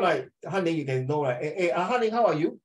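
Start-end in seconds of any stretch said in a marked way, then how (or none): 1.4-2.6 high-pitched "how are you", rising on YOU; question intonation. Shown as a demonstration. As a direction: call to a student, lifting "you" as a question. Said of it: "So from the tone".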